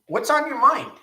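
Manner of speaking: called-out question. Real, not acted. Host calling out to his live viewers, loud and high. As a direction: call it out big to the whole room.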